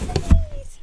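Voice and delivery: high, loud playful yell; mock shout. Reseller playfully yelling at the unknown brand, high and loud. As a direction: shriek it in silly bafflement.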